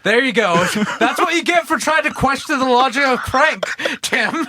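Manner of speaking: real laughter through a playful jab; laughing teasing. Real, not acted. Host teasing Tim while laughing hard.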